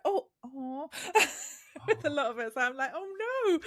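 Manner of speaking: high, half-laughing groan of disappointment; mock dismay. Telling how gluten-free ruined Rome's food, mock dismay. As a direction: play up the letdown, half laughing. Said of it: "so I was like".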